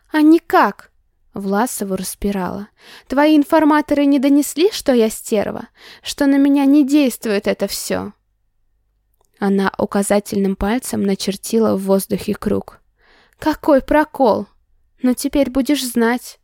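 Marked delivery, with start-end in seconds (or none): 0.1-0.9 loud, high snapped retort; angry outburst. Acted. Heroine bursting out. 3.1-8.1 angry rant. Acted. Heroine ranting at him. 13.4-16.4 mocking. Heroine mocking him.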